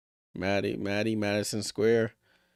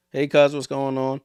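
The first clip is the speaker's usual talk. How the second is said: loud, higher movie Italian New Yorker greeting; brash Italian New Yorker accent, louder and higher; mock Italian New York accent; 6 semitones higher, 8 dB louder than usual. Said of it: "this thick Italian accent you only see in the movies".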